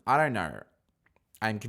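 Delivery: ordinary talk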